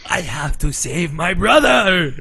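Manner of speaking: slow, overdramatic cry with a peak on BROTHER; hammy bad-dub anime hero. Podcaster mocking a terrible Yu-Gi-Oh dub, hammed-up heroic cry. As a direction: wail it like a bad dub actor. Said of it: "that dub is so bad".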